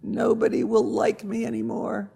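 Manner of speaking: high-pitched, dejected rooster; sad. A narrator's reading. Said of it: "sadly shook his head".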